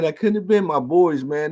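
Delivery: ordinary talk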